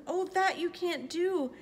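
high-pitched urgent plea; alarmed protest. A narrator's reading. Horton begging the kangaroo to spare the speck, alarmed protest. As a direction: plead in alarm, voice pushed up high. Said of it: "gasped Horton".

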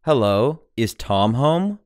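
ordinary talk

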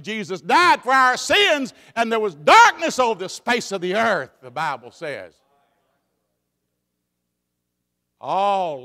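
loud ringing preaching; preaching at full force. Acted. Preacher proclaiming Jesus the light, loud. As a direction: declare it to the church.

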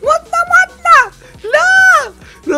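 high, loud, desperate repeated wail; panicked screaming. Real, not acted. Gamer watching his team die, screaming in panic. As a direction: scream it as everything falls apart.

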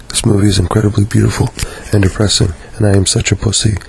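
ordinary delivery

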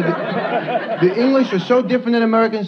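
ordinary talk, nothing marked

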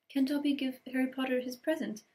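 high, timid elf squeak; tentative squeak. Acted. Elf offering Harry a present, timid squeak. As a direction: ask shyly like a hopeful house-elf. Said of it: "he squeaked tentatively".

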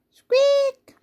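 high squeaky failed roar; a tiny mouse-like squeak instead of a roar. Acted. Tiny dinosaur tries to roar.

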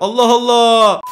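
ordinary talk

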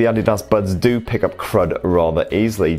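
ordinary talk, nothing marked

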